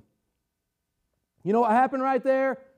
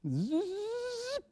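reel winding noise; 10 semitones higher, 14 dB quieter than usual. Pretend. Imitates reel winding back.

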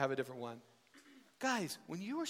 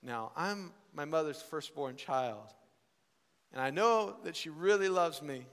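ordinary talk, nothing marked

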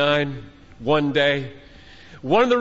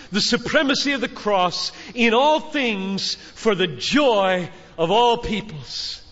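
fervent declaration; 5 semitones higher than usual. Acted. Declares mission statement fervently.

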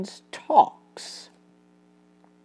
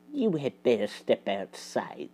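much softer, hushed warning; low voice; 8 semitones lower, 11 dB quieter than usual. Acted. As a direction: murmur it as a quiet threat. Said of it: "said Basil in a low voice".

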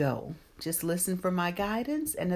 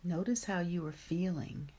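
guided meditation; 5 dB quieter than usual. Acted. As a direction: ease them gently into calm.

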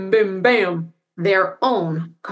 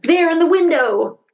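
excited cry; 8 semitones higher, 3 dB louder than usual. Acted. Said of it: "cried Jonathan".

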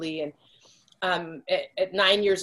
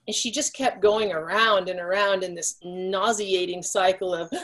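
ordinary talk, nothing marked

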